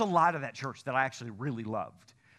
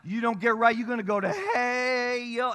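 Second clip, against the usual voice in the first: higher and louder shout with a two-syllable "hey, yo"; hellfire country preacher; 7 semitones higher, 5 dB louder than usual. Retold or imitated. Old pastor warning the country church, shouting. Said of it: "my pastor act like he was, like, born and raised in hell".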